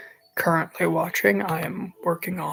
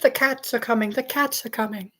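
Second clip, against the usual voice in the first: mock Paul Revere; 6 semitones higher than usual. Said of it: "Paul Revere be like".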